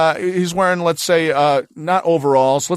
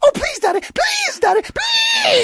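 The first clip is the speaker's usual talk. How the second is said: put-on begging child; slower than usual.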